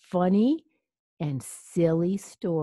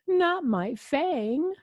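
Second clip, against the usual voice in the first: high, drawn-out storybook wail; cry; 10 semitones higher, 4 dB louder, much slower than usual. A narrator's reading. Vampire losing his fang, high mild cry. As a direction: wail it slowly, mock-tearful. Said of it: "Louis cried into his cape".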